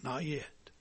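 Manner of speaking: very soft, low and plain; barely above a murmur, calm; simply said. Acted. Potter quietly refuses the teacup in the fire, soft. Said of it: "The master potter simply said".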